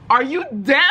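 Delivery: hammed-up irritation; mock indignation. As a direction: snap it as a playful, exasperated retort. Acted indignant phone retort.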